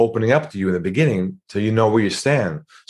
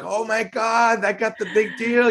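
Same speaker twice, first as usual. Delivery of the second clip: mock excitement; 13 semitones higher than usual. Mocking an overexcited salesperson.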